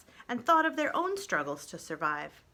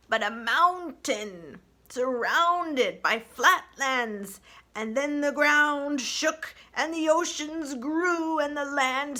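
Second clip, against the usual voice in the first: slow, higher, weighty ancient turtle; slow old turtle; 5 semitones higher, 5 dB louder, slower than usual. Acted. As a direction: speak slowly like an ancient sage. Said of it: "spoke slowly".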